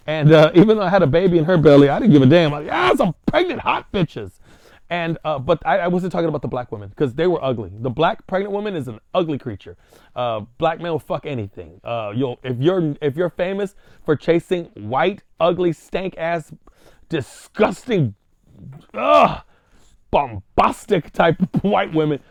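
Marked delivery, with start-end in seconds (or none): 2.7-4.3 high, drawn-out mock yell; exaggerated whoop, imitating an outburst; retold scream. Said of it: "I just screamed out". 18.9-19.4 high, loud "ugh" of revulsion; revolted groan, pitched up; disgusted groan. Acted. Revulsion amid a list of insults.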